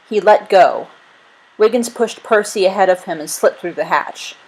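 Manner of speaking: ordinary delivery, nothing marked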